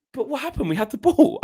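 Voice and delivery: high, bewildered questioning; baffled fan. Retold or imitated. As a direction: ask it like you can't believe your eyes. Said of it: "I said to the guy, I goes".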